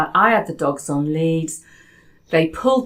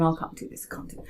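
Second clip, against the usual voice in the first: softer unvoiced whisper; whispered doubt; 9 dB quieter, much faster than usual. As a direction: mutter self-doubt under your breath. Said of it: "sitting there going".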